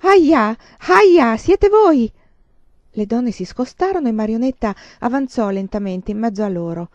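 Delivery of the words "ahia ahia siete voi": high, loud cry of recognition; sudden shout. A narrator's reading. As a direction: call out loudly, pitched right up. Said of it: "Improvvisamente una voce gridò". (grido improvviso, forte e acuto)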